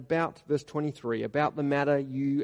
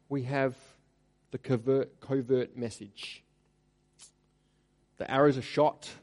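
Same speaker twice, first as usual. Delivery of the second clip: usual speaking voice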